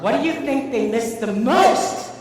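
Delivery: slow, swooping up high and loud on MOST; hammed-up rising question ending on MOST; exaggerated question mark. Shown as a demonstration. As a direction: drag it out, rising sharply at MOST. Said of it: "So back to the question mark".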